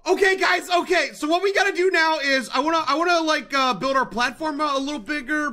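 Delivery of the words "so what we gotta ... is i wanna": usual speaking voice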